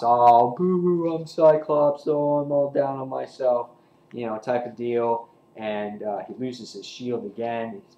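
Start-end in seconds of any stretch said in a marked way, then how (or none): 0.0-3.7 louder, higher mock sobbing self-pity; mock him as a crybaby feeling sorry for himself; mocking whiny Cyclops. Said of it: "again, Cyclops".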